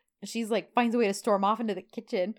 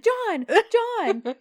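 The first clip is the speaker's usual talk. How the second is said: high, louder mock protest; 12 semitones higher, 6 dB louder than usual. Woman stopping her teasing man, high mock protest. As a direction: protest his name twice, pitched way up.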